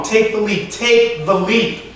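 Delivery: high, emphatic command, slowing on the repeat; strong boyhood voice. Acted. Boy he was urging the motionless man, strong command. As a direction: urge it strongly, stretching out the last time. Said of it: "His voice is loud and strong".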